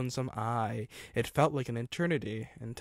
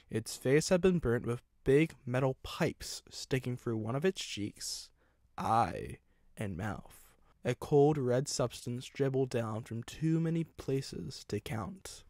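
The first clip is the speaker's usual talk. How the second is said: ordinary delivery, nothing marked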